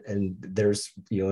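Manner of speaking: normal talking voice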